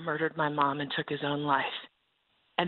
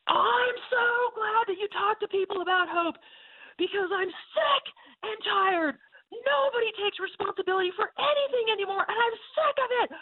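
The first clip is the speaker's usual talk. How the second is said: furious high shout at a stranger; yelling. Retold or imitated. Checkout woman yelling in the speaker's face, furious high shout. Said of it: "starts to yell in my face".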